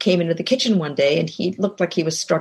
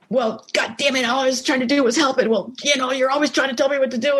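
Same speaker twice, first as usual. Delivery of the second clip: higher, fast, mock-exasperated outburst; mock quarrel; 5 semitones higher, faster than usual. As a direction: act out the fight that almost happened, hammed up. Mock escalated kitchen quarrel.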